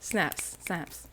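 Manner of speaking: soft snapping praise; soft mock poetry applause; quiet snaps. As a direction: murmur it like a poetry crowd.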